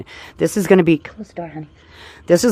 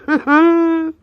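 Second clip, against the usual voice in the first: high laugh; 13 semitones higher, 5 dB louder than usual. Real, not acted. Laughs after cheerful line.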